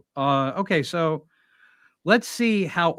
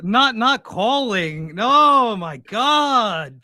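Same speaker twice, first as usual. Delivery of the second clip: sarcastic; 7 semitones higher, 4 dB louder, slower than usual. Acted. Mock shock at the stalking claim.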